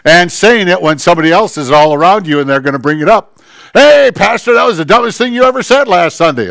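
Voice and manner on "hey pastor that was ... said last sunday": acted teasing shout, pitched up; mock heckling call-out. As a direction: call out to embarrass him publicly. Imitates a heckler calling out publicly.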